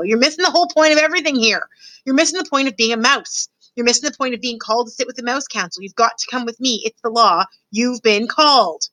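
a put-upon mouse fretting at his brother; fussy mouse brother Furlough. Acted.

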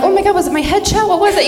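high, quick excited questions; mock eagerness, rushing the questions; eager questioning. Granddaughter asking dying grandma which picture, eager. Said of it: "I'm like".

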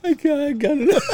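high laughing burst; cracking up at the Mexico joke; laughing shout. Real, not acted. Friend cracks him up, laughing shout.

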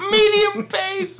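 high squeaky laughing voice; cracking up mid-word; giggling. Real, not acted. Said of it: "You're the worst at this game".

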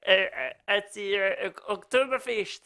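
bad German accent. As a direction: grope through a badly faked German accent. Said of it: "I'm so bad at accents".

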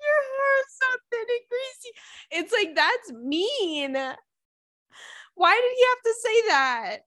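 very high wail through real laughter; crying with laughter. Real, not acted. Podcaster repeating the mean punchline in disbelief, crying with laughter. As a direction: squeal it out, helplessly tearful with laughing. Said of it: "I'm crying".

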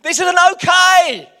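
loud, high shout of protest; passionate outburst. Retold or imitated. Pastor dad losing his temper at the match, shouted protest. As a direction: explode with outrage. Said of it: "But it was like".

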